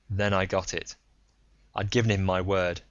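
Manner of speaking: plain reading voice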